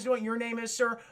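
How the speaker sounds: ordinary delivery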